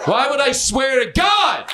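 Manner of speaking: mock indignant shout. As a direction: shout it at the crowd like you're wronged. Repeats his oath, now shouting.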